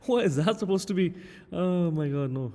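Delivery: laughing shock at a terrible drawing. Real, not acted. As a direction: burst out laughing at the mess.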